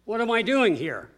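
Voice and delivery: ordinary talk, nothing marked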